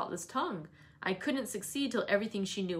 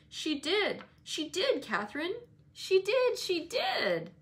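put-on high, repeated childish jeer; insistent playground chant; sing-song taunt; 10 semitones higher, 3 dB louder, slower than usual. A narrator's reading. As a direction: keep needling, rising to a louder crow. Boy keeps chanting his taunt.

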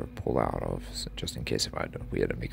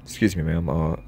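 loud, higher calls out the window; calling out; 6 semitones higher, 6 dB louder than usual. Acted. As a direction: call to the lady from the car.

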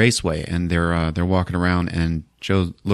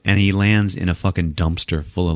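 ordinary talk

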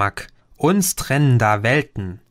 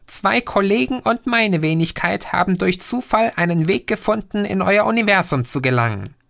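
lightly voiced alien, somewhat higher; a patient know-it-all explaining; Green's voice; 6 semitones higher than usual. Green reveals his chatting. (leicht höher gesprochener Außerirdischer, belehrend)

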